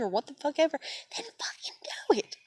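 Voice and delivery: unvoiced bitter whisper. Real, not acted. Dismissing her family's allies in a bitter whisper. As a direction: hiss it out under your breath.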